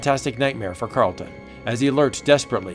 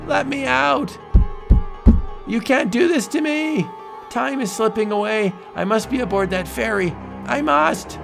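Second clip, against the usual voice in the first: high frantic shouts; panicked shouting; 11 semitones higher, 3 dB louder than usual. Acted. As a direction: pound the walls in panic. Trapped, panicking.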